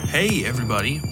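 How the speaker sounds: ordinary talk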